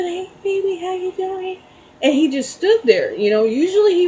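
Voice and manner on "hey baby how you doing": high, soft coo to a little animal; sweet baby talk. Retold or imitated. Calling sweetly to the groundhog in baby talk. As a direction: coo the greeting up high and gentle.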